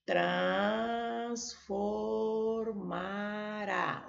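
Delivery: Transformará stretched very slowly, syllable by syllable. Shown as a demonstration. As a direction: drag out Transformará for the class. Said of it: "a sílaba tônica é a última".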